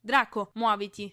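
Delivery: loud impatient call. A narrator's reading. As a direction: shout for him to hurry up. Calling Draco to hurry. (richiamo urlato e impaziente)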